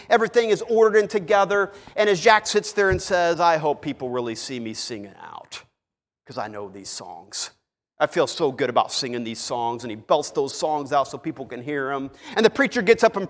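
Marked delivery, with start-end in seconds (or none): 3.4-9.9 smug inner voice. Acted.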